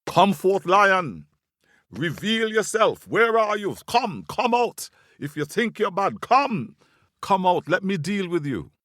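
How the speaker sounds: high, loud challenge to a fight; squirrel's badman voice. A narrator's reading. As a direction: call the enemy out, high and forceful. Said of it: "he put on his deepest badman voice".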